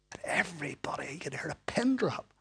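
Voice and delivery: soft whisper; hushed whisper. Real, not acted. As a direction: whisper the silence. Preacher hushes for the pause.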